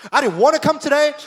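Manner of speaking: "wanna" pushed up high and loud; high loud complaint. Retold or imitated. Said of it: "you're like".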